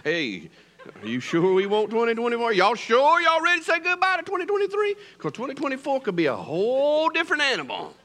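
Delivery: calling out to the congregation; rousing challenge. Real, not acted. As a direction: fire up the crowd.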